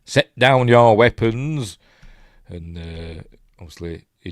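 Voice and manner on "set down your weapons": mock herald demand. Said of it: "here comes a chap".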